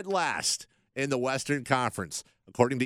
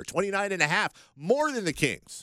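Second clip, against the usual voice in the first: teasing emphasis; 9 semitones higher, 3 dB louder than usual. Real, not acted. Teasing co-host about wrong guess.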